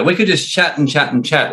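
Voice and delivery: ordinary delivery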